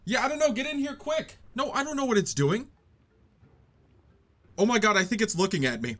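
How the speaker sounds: hushed, high-pitched panic; scared of the creature on TV; being chased. Acted. Acted scared phone call skit.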